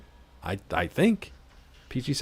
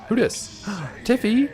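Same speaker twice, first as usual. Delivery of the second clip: excited greeting; 12 semitones higher, slower than usual. Real, not acted.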